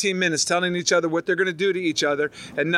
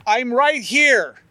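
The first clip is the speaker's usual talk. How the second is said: mock protest; 5 semitones higher, 4 dB louder, much slower than usual. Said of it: "and I'm like".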